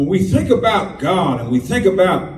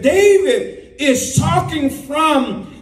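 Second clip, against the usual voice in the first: raised, impassioned pulpit voice; fervent preaching; 6 semitones higher, slower than usual. Acted. Preacher building up to the congregation about overflow. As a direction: drive the point home.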